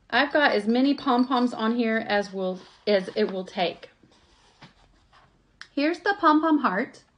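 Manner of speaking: ordinary delivery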